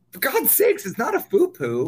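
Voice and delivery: retold outburst, pitched well up; exasperated. Correcting the manager's faux pas, exasperated retelling. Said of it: "and I'm like".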